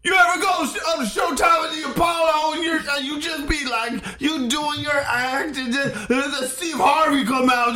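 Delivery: high, shouty hammed-up standup voice. Pretending to perform at the Apollo, high shouty standup. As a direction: work the crowd like a brash Apollo comic. Second mock standup bit.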